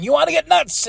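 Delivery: high-pitched wrestler challenge; Toni Storm. Acted. Host quoting Toni Storm's moment of the night, high challenge. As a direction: pitch the question way up, theatrically. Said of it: "Toni Storm saying".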